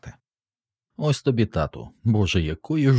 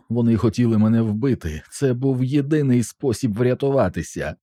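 higher-pitched, understated tearful plea; tearful pleading; 5 semitones higher than usual. A narrator's reading. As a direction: plead as if crying, voice lifted. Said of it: "плакав Джинчу". (благальний, трохи плаксивий високий голос)

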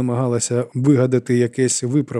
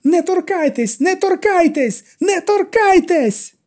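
much higher, slowing, dragged-out scream; shouting; 4 dB louder, slower than usual. A narrator's reading. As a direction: yell each word longer. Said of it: "І Мір'ям теж кричала".

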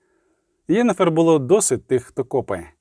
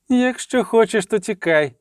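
high-pitched cry, only mildly raised in volume; narrated shout; 7 semitones higher than usual. A narrator's reading. Sorceress telling her frightened friend to flee, narrated shout. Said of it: "крикнула".

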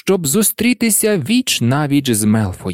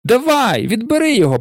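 high, mildly raised call; taunting call; 9 semitones higher, faster than usual. A narrator's reading. Malfoy calling down from his broom, taunting call. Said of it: "Мелфой гукнув".

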